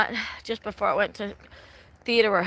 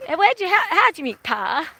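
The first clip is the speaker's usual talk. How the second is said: imitating a Western country accent, higher and louder; Western drawl; 6 semitones higher, 4 dB louder than usual. Daughters quizzing their stage mother, mock Western accent. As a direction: ask about Pa in a hammed-up Western twang. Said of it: "really doing the accent so well".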